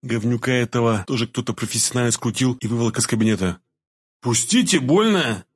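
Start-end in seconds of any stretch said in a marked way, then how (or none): 4.2-5.4 high, urgent cry of protest; pained yell. A narrator's reading. Man in a painful hold yells to be freed, high pained cry. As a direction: cry out to be released. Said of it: "заорал я".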